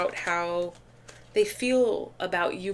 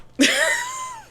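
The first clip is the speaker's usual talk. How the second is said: squeaky high giggle; high-pitched laugh; 4 dB louder than usual. Real, not acted. Laughs at her own tea remark.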